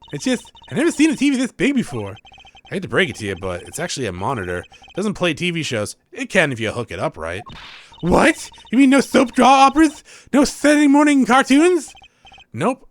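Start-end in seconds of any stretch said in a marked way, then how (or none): 0.1-2.2 put-on high, bright young magician girl; voicing the girl sidekick, perky and high; cheery girl voice. Voices Trucy in game dialogue. 8.0-11.9 put-on high, loud dismayed teen; girl sidekick aghast, high and loud; shocked girl voice.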